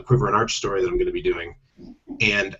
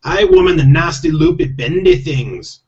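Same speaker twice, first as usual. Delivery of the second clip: loud, high, grumbling Scottish pixie; exasperated Scottish husband voice; growled Scottish; 6 semitones higher, 6 dB louder than usual. A narrator's reading. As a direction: grumble back in a thick Scottish accent. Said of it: "growled Rob".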